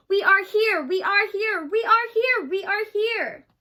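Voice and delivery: loud, high, desperate chant; frightened shouting. A narrator's reading. Whos crying out in fear to be heard, shouting high. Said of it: "they cried out in fear".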